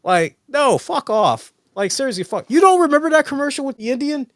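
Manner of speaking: worked-up disbelief; incredulous rant. Real, not acted. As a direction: rant about it, amazed. Ranting about the commercial.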